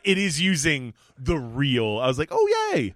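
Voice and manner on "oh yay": delighted squeal. Retold or imitated. Retold delighted reaction.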